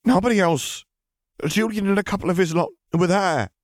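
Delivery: high, frantic confession; frightened high-pitched protest; desperate pleading. A narrator's reading. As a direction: blurt it out as if your life hangs on it. Pansy pleads for her life.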